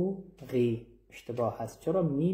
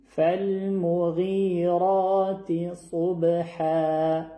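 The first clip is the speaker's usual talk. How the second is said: slow chanted tajwid recitation, drawn out; Quranic recitation; 3 semitones higher, 4 dB louder, slower than usual. Shown as a demonstration. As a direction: recite it slowly with full tajwid.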